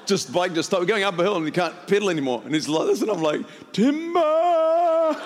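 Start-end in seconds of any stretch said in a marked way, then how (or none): 3.7-5.3 high, drawn-out lumberjack cry; mock timber call. Preacher joking about his friend's fall off the bike, mock timber call. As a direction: call it out long like a tree is falling. Mocks rider falling off bike.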